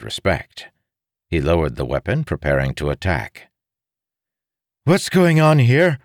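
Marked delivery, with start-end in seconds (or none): 4.8-6.0 high, loud woman's challenge; raised, commanding female voice; sharp feminine demand. A narrator's reading. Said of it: "a sharp feminine voice demanded".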